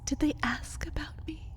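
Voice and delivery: hurt whisper. Acted. Vampire whispering to her partner about his human friends. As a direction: whisper it, afraid of the answer.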